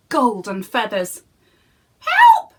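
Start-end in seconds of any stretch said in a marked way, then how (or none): 2.0-2.5 very high, loud squawk; clucking hen. Acted. Golden hen calling to Jack, high squawk. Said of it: "she clucked".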